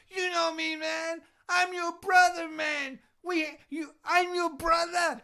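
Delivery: hammed-up fan begging to be remembered; pleading crazy fan. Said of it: "he's like".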